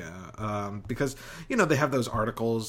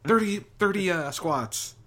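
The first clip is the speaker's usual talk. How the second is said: drill sergeant; 8 semitones higher, slower than usual. Pretend. Trainer bit continues.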